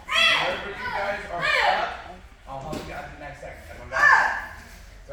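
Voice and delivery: loud, high call across the gym; calling out to class. Real, not acted. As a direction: project it to the whole class.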